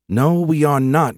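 flatly refuse, stretching the words high; emphatic contradiction. Acted. Squadmate contradicting the private, emphatic. Squadmate snaps back at Larry.

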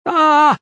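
high, loud battle cry; one sustained attacking yell; charging yell. A narrator's reading. Young man charges the platform at his father's killer, yelling.

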